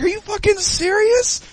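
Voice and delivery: high, loud, incredulous outburst; disbelief. Retold or imitated. Son to his mom, high disbelieving outburst. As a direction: shoot up in disbelief. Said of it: "I'm like".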